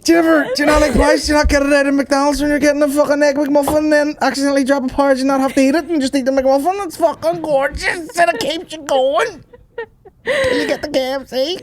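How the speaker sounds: high, fast, breakneck comic rant, slowing at the end; frantic porridge enthusiast. Acted. Raving about McDonald's porridge, in frantic high mock delight. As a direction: rattle it off at a squeaky pitch.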